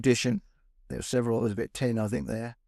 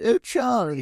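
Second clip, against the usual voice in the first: high, louder call of the house's lady; 11 semitones higher, 4 dB louder, slower than usual. Acted. Lady of a smart house calling to her plumber, high-voiced householder. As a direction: sing out as a refined householder. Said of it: "the lady of the house coming through".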